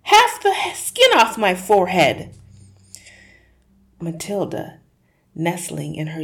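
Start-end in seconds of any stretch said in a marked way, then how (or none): 0.0-2.3 high, loud, frantic protest; panicked shouting. Acted. Husband shouting at wife to stop pulling his hat. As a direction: yell at her to let go. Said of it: "he screamed".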